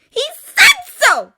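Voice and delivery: very high, drawn-out yell; slow, full-volume furious shout; shouted insistence. A narrator's reading. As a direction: scream it slowly, word by word. Repeats it, shouting.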